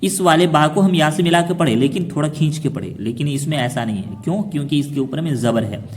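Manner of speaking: ordinary delivery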